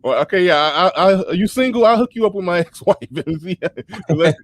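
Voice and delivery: high-pitched, laughing as you talk; cracking up. Real, not acted. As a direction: tease through real laughter. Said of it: "Big Nate killing me".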